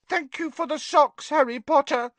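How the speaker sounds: high, mournful elf call; miserable Dobby. Acted. Dobby calling miserably to Harry from the hearth. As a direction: call it out sadly across the room. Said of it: "Dobby called miserably".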